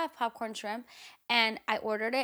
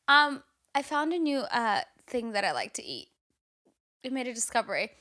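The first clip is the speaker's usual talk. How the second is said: ordinary talk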